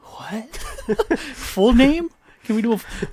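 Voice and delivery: very high mock disbelief; retold incredulity. Members testing Kasuga on names, mock incredulous. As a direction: imitate members astonished, amid laughter.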